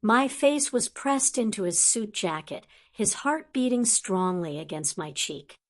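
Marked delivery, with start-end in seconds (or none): none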